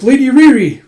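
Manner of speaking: high, loud cry of a name; alarmed cry. Acted. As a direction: cry out in horror. Rescuer sees mistress struck.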